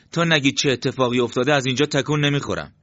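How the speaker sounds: adamant, agitated protest; stubborn refusal. Acted. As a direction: dig in and refuse, loudly. Refuses to move until told.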